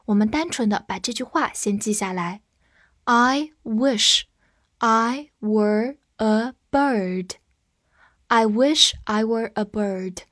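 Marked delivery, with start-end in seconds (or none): 3.0-7.3 slow, separated words; slow reading. Shown as a demonstration. Teacher helping learners memorise the sentence, slow reading. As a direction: read it word by word with pauses.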